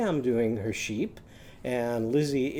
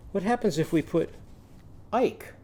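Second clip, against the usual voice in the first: usual speaking voice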